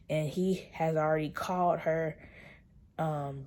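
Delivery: usual speaking voice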